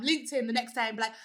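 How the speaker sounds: normal talking voice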